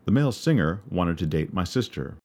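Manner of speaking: plain reading voice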